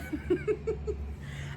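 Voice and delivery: high breathy laugh; high giggle. Real, not acted. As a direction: giggle at your own joke. Laugh after voicing husband.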